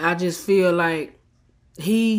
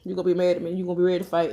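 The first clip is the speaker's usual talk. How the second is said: normal talking voice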